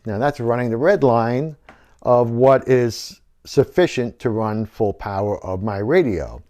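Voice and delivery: ordinary talk, nothing marked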